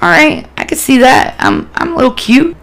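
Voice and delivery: playfully warming to yourself; tentative self-belief. Retold or imitated. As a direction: try the compliment on for size. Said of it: "kinda started believing myself a little bit".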